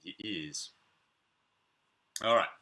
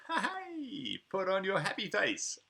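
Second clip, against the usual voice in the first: laughing, with a high Ha-hey; cheerful mock delight; happy face; 10 semitones higher than usual. Shown as a demonstration. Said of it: "make my face look happy".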